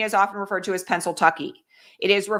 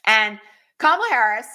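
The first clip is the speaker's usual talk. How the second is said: ordinary delivery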